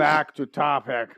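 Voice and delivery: firm loud call. Real, not acted. Calling group back.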